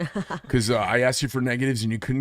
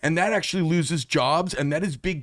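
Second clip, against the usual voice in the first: outraged rant; 6 semitones higher than usual. Retold or imitated. Ranting at a movie.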